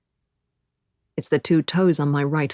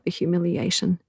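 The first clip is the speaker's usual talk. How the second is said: usual speaking voice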